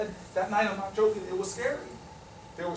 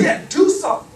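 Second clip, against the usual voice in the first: higher, louder confirmation; raised, punchy retort; emphatic insistence; 8 semitones higher, 9 dB louder than usual. Retold or imitated. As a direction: fire it back with full conviction. Miss Jones insists, acted.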